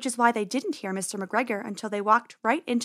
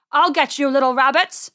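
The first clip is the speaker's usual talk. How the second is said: loud, raised angry farmer voice; menacing farmer's yell; shouted threat; 4 semitones higher, 7 dB louder than usual. A narrator's reading. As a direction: shout after the escaping rabbits. Farmer threatens the fleeing rabbits.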